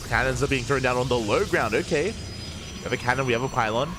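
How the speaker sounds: energetic esports caster; live play-by-play. Acted. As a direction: call the match like a live broadcast. Live casting of the game.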